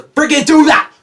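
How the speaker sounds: sudden loud, annoyed yell; real exasperated shout; frustrated outburst. Real, not acted. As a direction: burst out at the misbehaving phone. Phone interrupts his recording.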